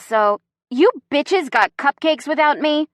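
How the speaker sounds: acted outrage, pitch lifted; outraged. A narrator's reading. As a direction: demand it, affronted. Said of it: "Chrissy's voice is outraged".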